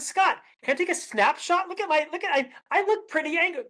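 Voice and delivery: high, showing a photo; imitating a colleague. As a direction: prove the point. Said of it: "And I said".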